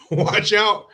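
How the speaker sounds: high, excited mock alarm; excited warning. Real, not acted. Dad warning about his handsome son, high and excited. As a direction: sound a playful alarm. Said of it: "repeats the warning".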